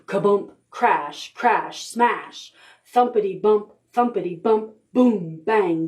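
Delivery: ordinary delivery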